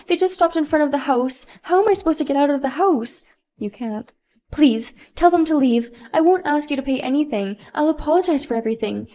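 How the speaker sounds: high, loud, frantic pleading; panicked spoiled mom. Acted. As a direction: panic as the police pull up. Sophia panics at sirens.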